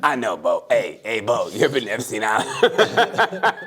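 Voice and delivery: very low robotic voice; Stephen Hawking. Acted. Joking guest questioning Bo, low Hawking-like voice. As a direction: drop down low like a speech machine. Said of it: "Sound like Stephen Hawking".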